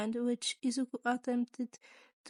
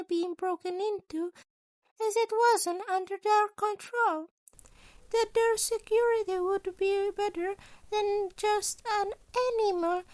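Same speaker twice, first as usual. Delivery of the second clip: high, bright-eyed principal voice, raised; a sly, chipper school principal asking; Nezu's voice; 8 semitones higher than usual. A narrator's reading.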